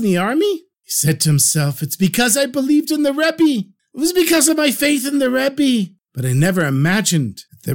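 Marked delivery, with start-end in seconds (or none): 1.8-5.9 high, astonished voice of wonder; amazed realization. A narrator's reading. Mendel David to himself, freed from the army, amazed and high.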